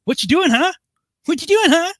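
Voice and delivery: high parrot mimicry, taunting. Jealous parrot taunting its owner's new husband in a high parrot voice. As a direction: chirp it up high like a pestering parrot. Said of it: "he would go".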